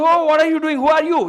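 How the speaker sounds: loud, high startled outburst; startled exclamation. Retold or imitated. Healer startled when a stranger grabs his feet, loud and high. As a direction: jump back in surprise at a stranger at your feet.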